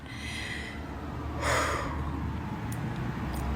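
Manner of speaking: long, drawn-out sigh; long exhale. Retold or imitated. Trying to calm down behind the door, long exhale. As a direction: breathe out at length to steady yourself. Calming herself after panicked begging.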